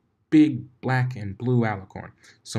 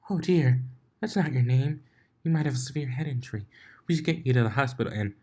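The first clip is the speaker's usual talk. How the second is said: higher, fast, fretful pony; worried Fluttershy; 5 semitones higher than usual. Acted. Fluttershy fretting over Blitz's head injury, fast. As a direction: fuss over him in a rush.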